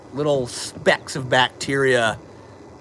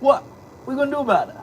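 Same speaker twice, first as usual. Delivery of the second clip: pet talk; 12 semitones higher than usual. Real, not acted. Streamer playfully dares his pet, squeaky baby voice. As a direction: tease the pet in a squeaky challenge.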